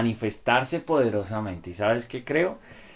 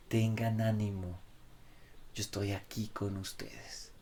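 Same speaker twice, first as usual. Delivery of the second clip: tender reassurance; 11 dB quieter than usual. Retold or imitated. Preacher voices Jesus softly.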